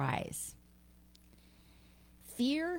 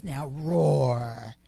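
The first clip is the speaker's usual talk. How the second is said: low, louder, growly order to roar; lioness command; 6 semitones lower, 6 dB louder than usual. Acted. Lioness ordering the cub to roar, low and loud. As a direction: demand the roar from deep down.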